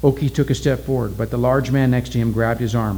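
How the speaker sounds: ordinary delivery, nothing marked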